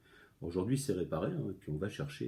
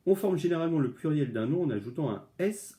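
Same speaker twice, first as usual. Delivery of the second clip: higher, louder textbook reading; reading the rule aloud; 6 semitones higher, 4 dB louder than usual. Shown as a demonstration. Teacher reads the plural rule to pupils, reading voice. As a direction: read the plural rule straight from the book.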